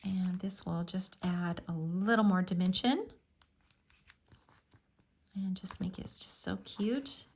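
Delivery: whispered while concentrating; murmured to yourself, no voice; whispering. Real, not acted. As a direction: drop to a whisper as you work. Whispers while placing dots.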